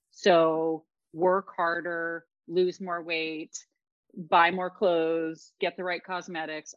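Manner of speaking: slow, deliberate list of demands; mock commands. Host voicing culture's demands on women, slowly. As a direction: spell out each order like a drumbeat.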